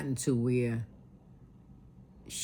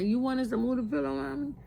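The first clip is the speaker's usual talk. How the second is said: retold gentle question; 9 semitones higher, faster than usual. Daughter gently asks dying mother.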